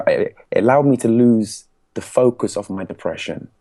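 ordinary delivery

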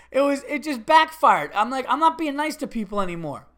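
loud, high, worked-up complaint; exasperated. Real, not acted. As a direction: throw your hands up, fed up with people. Exasperated punchline after laughing.

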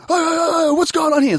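high-pitched mock outcry; high, agitated bogan heckling; alarmed bogan gang. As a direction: imitate a mob piling in to intervene. Retold bogan gang rushing over.

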